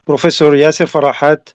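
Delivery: usual speaking voice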